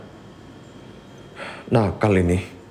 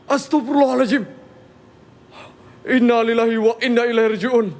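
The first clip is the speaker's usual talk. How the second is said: high, panicked prayers; frightened; 8 semitones higher than usual. Real, not acted. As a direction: blurt the prayers out, spooked. Chair moved, panicking. (istighfar panik dengan suara tinggi)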